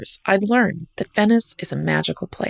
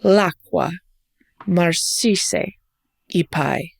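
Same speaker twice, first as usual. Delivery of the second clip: L'acqua, marcisse, i pai in separate, deliberate pieces; each syllable emphasized; much slower than usual. Retold or imitated. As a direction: place each syllable of "L'acqua, marcisse, i pai" like a note, pausing between phrases. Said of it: "emphasizing each syllable like a note".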